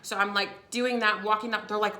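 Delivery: ordinary talk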